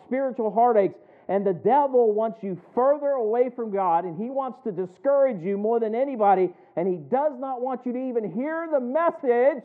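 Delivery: loud, rising pulpit cadence; preaching at full force. Acted. Pastor warning the congregation about Satan, loud preaching. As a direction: drive it home to the congregation.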